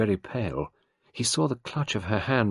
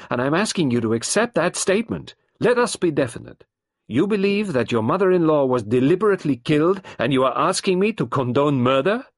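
higher and louder, intense back and forth; detective and wife clash, voices high and loud; heated confrontation; 8 semitones higher, 8 dB louder than usual. A narrator's reading. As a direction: push hard, neither side yields. Heated exchange, both voices.

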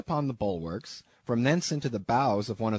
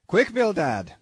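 higher, louder hurried call to a partner; urgent call; 7 semitones higher, 6 dB louder than usual. Acted. Peleg urging his partner Bildad after the flying harpoon, louder. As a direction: hurry him along after the close shave.

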